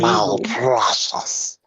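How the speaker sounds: very high, slow, hissing creature voice; Smeagol. Acted. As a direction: draw it out like a creepy cave creature. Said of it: "I can do Smeagol".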